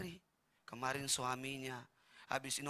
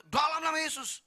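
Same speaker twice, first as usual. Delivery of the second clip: much higher, louder showy prayer shout; mock fervent prayer; 13 semitones higher, 11 dB louder than usual. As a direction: parody a dramatic healing prayer. Mock prayer over elderly. (doa berapi-api yang dibuat-buat)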